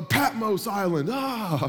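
hammed-up shouting of a holy-sounding name; mock prayer yelling. Preacher mocking showy prayers, yelling. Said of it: "You're just yelling the biggest words".

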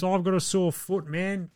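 mock sore-foot complaint, lightly voiced; half-hearted whiny excuse; mocking whine.

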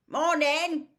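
big, high, loud cheery greeting; booming a grin into it; Hagrid greeting. A narrator's reading. Hagrid greets Harry, Ron and Hermione, loud and cheery. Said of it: "grinning at Harry".

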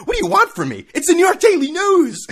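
incredulous near shout; exasperated outburst. Real, not acted. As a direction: throw up your hands at the absurdity.

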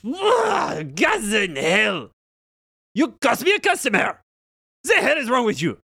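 high, loud outraged yell; hammed-up angry shopkeeper shout; Myconid yelling. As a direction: shout at them for costing a sale. Myconid yells at the party.

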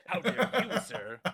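high-pitched mock indignation; hammed-up affronted movie president; jowls flapping. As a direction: bluster with wobbling jowls. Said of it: "jowls flapping".

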